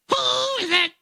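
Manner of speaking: very high parrot squawk. Acted. Bird at the door squawking at the plumber, very high. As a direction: screech it like a parrot. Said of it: "The bird says".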